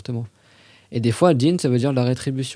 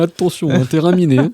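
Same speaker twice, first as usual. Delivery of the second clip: mock warning; 5 semitones higher, 7 dB louder than usual. Real, not acted. Joking warning to student.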